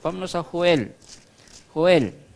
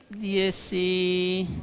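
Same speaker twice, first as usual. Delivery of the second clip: ordinary talk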